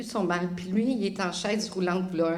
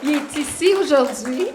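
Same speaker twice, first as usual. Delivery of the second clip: loud and high, rising at the end; excited announcement; 6 semitones higher, 10 dB louder than usual. Real, not acted. Pointing out the healed man to the church, excited. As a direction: announce it with joy.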